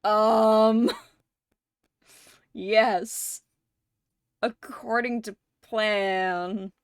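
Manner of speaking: halting, drawn-out 'um', then a stiff cover-up; hesitant mock composure with long pauses; imitating awkward bluffing. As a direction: play caught off guard, pretending it was intended. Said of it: "and he's like".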